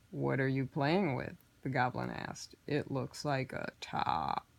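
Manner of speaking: low and patient, a scheming goblin; horned goblin prodding quietly; sly goblin. Acted.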